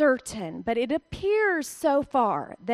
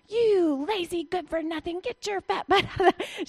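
mock scolding; 6 semitones higher than usual. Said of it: "I would curse like a sailor".

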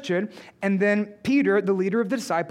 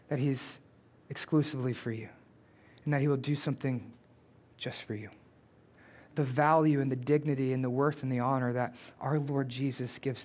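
much softer and lower, with long pauses; tender reassurance; 8 semitones lower, 7 dB quieter than usual. Real, not acted. Preacher assures the women in church they are loved, tender and hushed. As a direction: drop to a hushed, intimate register and let each phrase settle.

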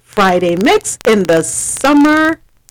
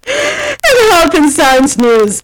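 news anchor intro; 5 semitones higher, much slower than usual. Acted. News segment opener.

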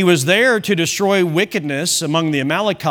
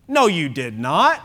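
usual speaking voice

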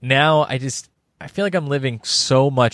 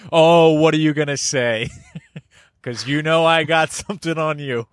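higher, lightly hammed-up gotcha taunt; mock taunting; 5 semitones higher than usual. Podcaster playing someone with dirt on Germany, lightly mock-taunting. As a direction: taunt them in a put-on, raised voice.

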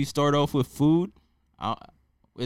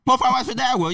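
a hammed-up Chinese restaurant accent, pitched higher; mock Chinese takeout accent; 7 semitones higher, 4 dB louder, faster than usual. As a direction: play the takeout worker asking about sauces in a mock Chinese accent. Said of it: "I don't even know what accent that is".

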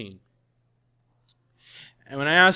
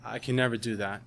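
quiet refusal; 5 semitones lower, 9 dB quieter, faster than usual. Retold or imitated. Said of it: "And she said".

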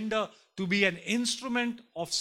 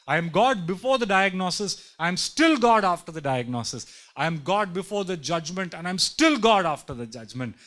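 louder, ringing declaration of God's words; booming proclamation; 9 dB louder than usual. Acted. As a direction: proclaim it like thunder from the pulpit.

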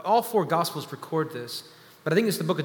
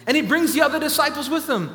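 higher and louder, rising fervor; building preaching; 6 semitones higher, 4 dB louder than usual. Acted. Preacher driving the congregation toward the climax, rising and loud. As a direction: preach it up, climbing with every line.

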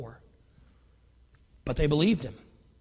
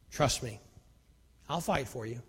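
much softer, lower promise; hushed reassurance; 7 semitones lower, 12 dB quieter than usual. Acted. God assures Israelites as army approaches, softly. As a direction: let it sink to a near hush.

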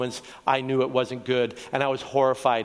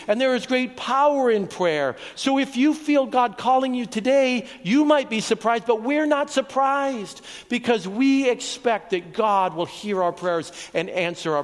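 fervent preaching; 8 semitones higher than usual. Acted. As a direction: preach it with rising conviction to the crowd. Preaching at full force.

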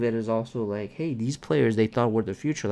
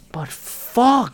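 frustrated curse; 10 semitones higher, 4 dB louder than usual. Real, not acted. Venting frustration.

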